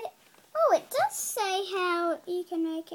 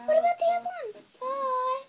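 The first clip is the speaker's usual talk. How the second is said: tearful, higher and louder whine; crying protest; 9 semitones higher, 3 dB louder, faster than usual. Real, not acted. Child begs for another book at bedtime, crying.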